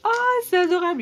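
loud high gush of delight; delighted squeal. Real, not acted. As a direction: burst out in real delight. Delighted unwrapping a gift.

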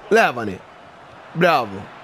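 normal talking voice

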